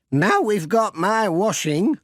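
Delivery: put-on high, slow old lady voice; fussing, grateful grandmother; Granny Dryden's voice. Old lady thanking the postman for chasing her washing, high and fussing. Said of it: "said Granny Dryden".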